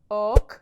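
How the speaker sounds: ok as the short, opened vowel of book. Shown as a demonstration. Teacher isolating the open vowel, short ok. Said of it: "the second one was open".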